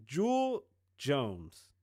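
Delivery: carefully correcting the name; slow careful naming. Shown as a demonstration. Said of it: "slow corrected take".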